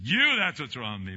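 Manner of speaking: put-on loud snapped retort at the child; loud mock-angry comeback; retold outburst. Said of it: "and I said".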